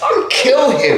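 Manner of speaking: high loud brash boast, imitating his old self; cocky boast. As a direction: brag it with hammed-up swagger. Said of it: "I was like".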